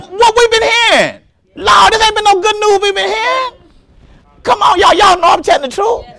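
high, shouted preaching. Real, not acted. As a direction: lift the congregation with it. Preaching climax.

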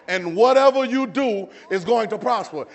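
loud, slow scripture proclamation; full-force preaching. Real, not acted. Preacher promising the congregation prosperity from scripture, emphatic preaching. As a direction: proclaim it slowly and firmly.

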